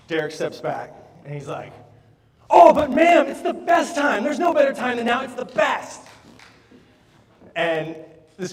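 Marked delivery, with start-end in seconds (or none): 2.5-6.0 high, loud, beaming sales pitch; overly enthusiastic salesman. Retold or imitated. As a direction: pump every word full of eager cheer. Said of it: "He's so enthusiastic".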